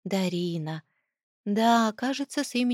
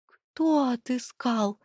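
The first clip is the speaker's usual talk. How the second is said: breaking whisper; 4 semitones higher, much slower than usual. Acted. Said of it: "срывающимся голосом прошептала я".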